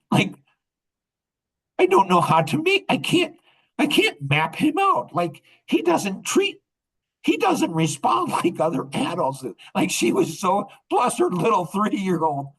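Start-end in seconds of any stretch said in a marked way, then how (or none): 1.8-9.5 put-on high childlike voice, baffled; granddaughter's thoughts. Grandpa voices granddaughter's confused thoughts, high. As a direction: voice a puzzled toddler well above your own pitch. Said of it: "she's like".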